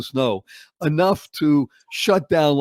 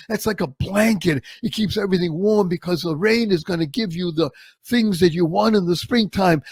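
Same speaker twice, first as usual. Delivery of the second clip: loving aunt; 6 semitones higher than usual. Retold or imitated. Aunt gently teaching her nephew about snow, loving and higher. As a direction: coax the child into wonder, tenderly. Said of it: "she said it so wondrously and lovingly and caring".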